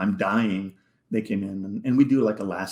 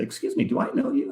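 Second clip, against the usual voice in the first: lightly voiced stranger, pitched up high; stranger's high voice; faster than usual. To the man in Phoenix, a curious stranger asks, high and polite. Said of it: "people say".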